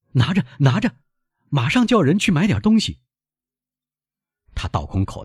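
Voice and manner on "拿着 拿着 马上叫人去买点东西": high, eager urging; excited son. Acted. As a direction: press money on your father excitedly. Son pours out his money.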